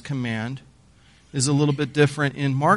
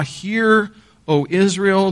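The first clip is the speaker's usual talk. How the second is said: raised and louder, a solemn call; proclaimed scripture; 5 semitones higher, 4 dB louder, slower than usual. Acted. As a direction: ring out the opening like a summons to the people. Preacher proclaims the Shema opening.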